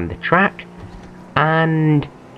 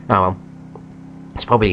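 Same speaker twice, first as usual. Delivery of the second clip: losing his voice, hoarse; hoarse, strained raspy voice; 4 semitones lower, 6 dB quieter, much faster than usual. Real, not acted. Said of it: "I'm losing my voice".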